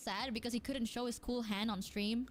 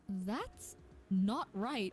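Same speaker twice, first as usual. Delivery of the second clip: slow deadpan verdict; 5 semitones lower, much slower than usual. Retold or imitated. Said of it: "He said it like that".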